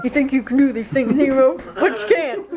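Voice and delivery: [name] line through giggles; cracking up mid impression; breaking character giggling. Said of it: "I can't do it with a straight face".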